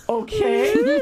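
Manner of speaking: high, loud rising squeal; mock starstruck. Imitating herself agreeing to keep hugging the singer, giddy squeal. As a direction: squeal it through a laugh. Acts starstruck reply to hug.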